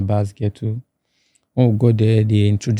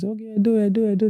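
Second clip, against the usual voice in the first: high, light repeated call; imitated welcomers; 11 semitones higher, 4 dB quieter, faster than usual. Camp people hear his home state, high imitated response. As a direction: pitch it well up, passing the word along.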